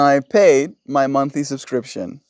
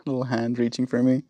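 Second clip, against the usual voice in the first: ordinary talk, nothing marked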